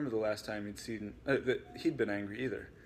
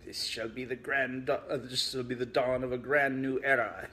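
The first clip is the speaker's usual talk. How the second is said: triumphant villain; 3 semitones higher, 6 dB louder than usual. Acted. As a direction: declare it like a gloating mastermind. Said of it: "he declared triumphantly".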